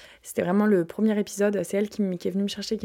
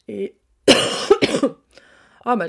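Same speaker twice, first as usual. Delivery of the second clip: sudden coughing fit; coughing mid-sentence; 3 semitones higher, 10 dB louder, much slower than usual. Real, not acted. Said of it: "j'arrête pas de tousser". (quinte de toux)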